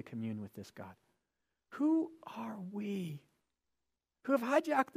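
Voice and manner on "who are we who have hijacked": slow, breathy whisper in wonder; awed whisper. Real, not acted. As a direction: hush the question in awe. Awed rhetorical question.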